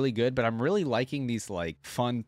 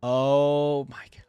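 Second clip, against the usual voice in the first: amazed; 3 semitones higher, 6 dB louder, much slower than usual. Real, not acted. Surprised by chroma changing shield.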